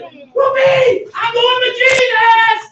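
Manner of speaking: high, loud cry of joy; joyful whoop. Retold or imitated. Preacher voicing his joy at Jesus coming home. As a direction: whoop it out like you just got picked.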